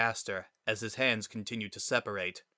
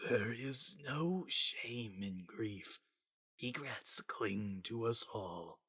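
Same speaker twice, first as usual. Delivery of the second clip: faint, slow breathy near-whisper; barely there, fading away; a dying voice, soft and distant; 9 dB quieter, slower than usual. A narrator's reading. Gaster's dying voice.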